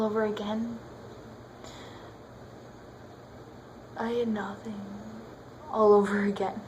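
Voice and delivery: low, soft, hollow delivery with long pauses; defeated monologue. Pretend. Jilted ex gives back the ring with nothing left, quiet and defeated. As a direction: let it sink into quiet humiliation.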